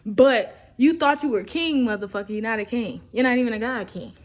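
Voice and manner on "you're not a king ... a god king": ordinary delivery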